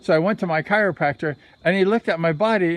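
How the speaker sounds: normal talking voice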